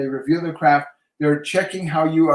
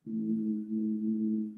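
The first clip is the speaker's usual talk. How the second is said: low hummed tone; 4 semitones lower, 9 dB quieter than usual. Shown as a demonstration. Psychic demonstrating the tone generator sound: low steady hum. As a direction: hum that single low note again, steady and even. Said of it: "This is a very low tone".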